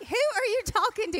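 high, loud mock-exasperated wife; exasperated retort. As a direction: act out a wife yelling back at her panicking husband. Exasperated retort to panicking husband.

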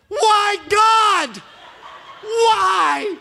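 high, loud wail of why; anguished cry to God. Retold or imitated. Eight-year-old wailing at God over the dog. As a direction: cry out to heaven, half laughing. Kid grieving the dead dog.

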